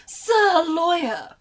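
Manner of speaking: loud, slow gloating drawl; smug prosecutor. Acted. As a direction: savour every word like a smug rival. Voicing prosecutor with relish.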